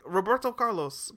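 ordinary delivery

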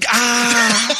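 high, drawn-out cry of pain; pained yell. Retold or imitated. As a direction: yell "ah" as if landing badly. Acting out painful landing.

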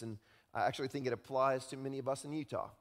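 ordinary talk

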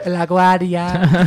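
drawn-out Boston LaGuardia, laughing; Boston accent. Shown as a demonstration. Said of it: "practicing his Boston accent".

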